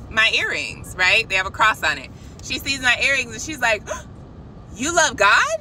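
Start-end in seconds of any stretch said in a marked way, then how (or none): 3.9-4.7 quick, soft gasp of delight; imitated gasp of surprise; surprised gasp. Retold nail tech's reaction to earrings. 4.8-5.6 higher, louder delighted question; excited mock exclamation, pitch rising at the end; imitating excited woman. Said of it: "she was just so excited".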